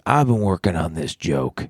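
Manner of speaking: slow, lightly imitated booming voice; Jeff. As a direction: draw it out like a deep-voiced older comic. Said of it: "such a low, booming voice".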